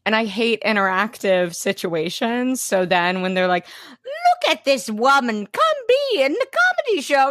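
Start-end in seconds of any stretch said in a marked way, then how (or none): 4.0-7.3 high, fast hammed-up hype voice; pushy performer. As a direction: overeager showman calling you up.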